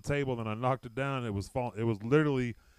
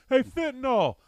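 buddy calling; 13 semitones higher, 5 dB louder than usual. Retold or imitated. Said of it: "his buddy's like".

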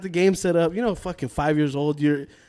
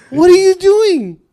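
loud, high, slow alarmed question; alarmed teacher; 12 semitones higher, 9 dB louder, slower than usual. Retold or imitated. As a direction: demand it loud and slow, shocked. Retold yelling at kindergarten kid.

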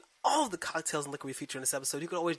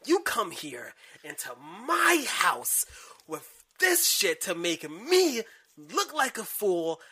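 booming wrestler boasts; 6 dB louder than usual. Pretend. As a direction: cut a promo, brother, full bluster. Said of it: "I'm gonna cut a wrestling promo on you".

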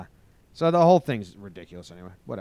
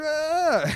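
laugh; 4 dB louder than usual. Real, not acted. Laughing at own radio voice.

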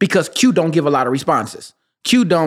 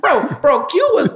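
ordinary talk

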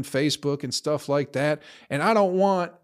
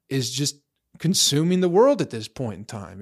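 normal talking voice

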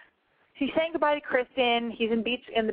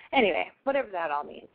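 usual speaking voice

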